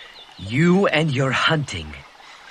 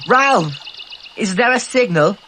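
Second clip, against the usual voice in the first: higher, louder anxious call; urgent call; 6 semitones higher, 4 dB louder than usual. Acted. Boy calling to Ralph about the signal fire, urgent shout. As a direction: shout across to Ralph in alarm.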